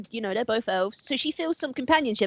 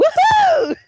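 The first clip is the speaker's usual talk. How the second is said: loud, high whoop; celebrating; 9 dB louder than usual. Real, not acted. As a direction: let out a high, triumphant cheer. Celebrating a move in the game.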